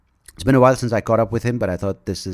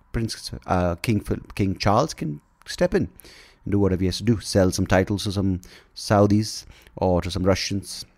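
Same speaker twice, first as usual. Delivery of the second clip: normal talking voice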